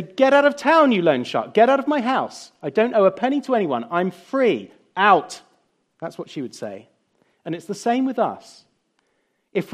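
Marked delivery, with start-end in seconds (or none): 0.0-5.4 higher and louder, throwing the loan shark out; a wife who knows she owes nothing, furious; defiant telling-off. Acted. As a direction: chase him off the doorstep with a fast, fierce rebuke. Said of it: "She'd say".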